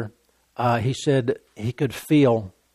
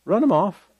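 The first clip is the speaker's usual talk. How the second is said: ordinary talk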